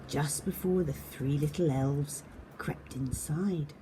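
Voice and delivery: soft, whispery suspense narration; hushed storytelling. A narrator's reading. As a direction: draw the children in with a secret hush.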